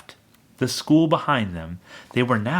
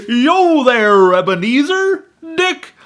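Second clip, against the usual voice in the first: high, much louder hearty call; rich jovial voice; 13 semitones higher, 9 dB louder, slower than usual. A narrator's reading. Fezziwig calling his apprentices, rich jovial shout. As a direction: boom it out like a jolly fat master. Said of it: "comfortable, oily, rich, fat, jovial voice".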